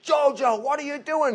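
high, loud mock dad yell; alarmed dad. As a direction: call out like a startled parent. Dad scolding child in retold story.

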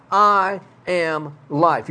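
each word slowed and weighted; slow, solemn emphasis on "I am life."; slow emphatic statement. Shown as a demonstration. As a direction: lay out every word deliberately. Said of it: "you're trying to emphasize something".

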